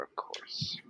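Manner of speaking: soft whispered aside. Acted. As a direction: drop to a whisper. In-character aside to Freya.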